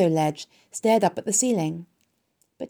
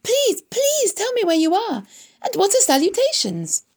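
screamed; 13 semitones higher, 5 dB louder than usual. A narrator's reading. Pig begging the hidden voice to show itself, screaming. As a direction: beg at a high-pitched shout. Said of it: "screamed Wilbur".

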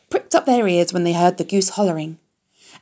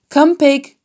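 louder coaxing call to the pig; coaxing farmer, louder; 8 semitones higher, 7 dB louder than usual. A narrator's reading. Farmer tapping pail, calling pig again, louder coax. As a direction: call the pig again, a bit more firmly. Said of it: "said Mister Zuckerman".

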